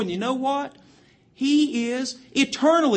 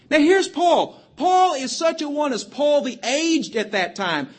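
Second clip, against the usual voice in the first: fiery preaching; 5 semitones higher, 3 dB louder than usual. Acted. Preaching builds to full force.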